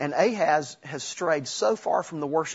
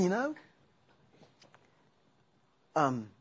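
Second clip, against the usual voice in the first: ordinary talk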